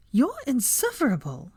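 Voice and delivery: exclaiming. Acted. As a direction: throw it at him in mock exasperation. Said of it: "she cried".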